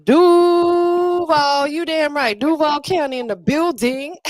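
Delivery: loud proud call of Duval, up high; hype shout-out. Real, not acted. Host hyping his hometown to the stream, loud and proud. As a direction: shout out the hometown like a crowd-pleaser.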